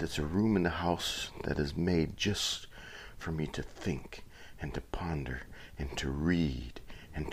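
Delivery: hushed so the wife sleeps; quiet at night. Real, not acted. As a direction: keep it soft, someone's asleep upstairs. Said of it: "I'm gonna be kinda quiet because".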